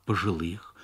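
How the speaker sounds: ordinary delivery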